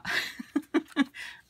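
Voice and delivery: high unvoiced giggle; breathy giggle. Real, not acted. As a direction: let out a delighted giggle. Laugh after giveaway.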